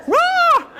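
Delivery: put-on sudden high shout of anger at the child; mock angry roar. Shown as a demonstration. Parent roaring at the child over toilet paper, mock angry. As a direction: bark it like a fed-up parent.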